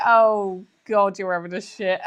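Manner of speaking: laughing while voicing Tom; breaking up mid-quote; giggling through the quote.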